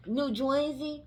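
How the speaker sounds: high mock Jersey pronunciation; mocking "Joisey" accent; New Jersey accent. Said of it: "people who sound like that".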